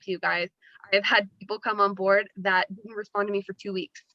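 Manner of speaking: usual speaking voice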